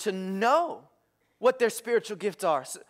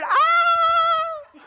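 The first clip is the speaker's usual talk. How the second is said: long, very high, loud shriek; imitated screaming girls at a concert; hammed-up wailing scream; mock fan scream; 7 dB louder than usual. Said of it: "bawling their eyes out".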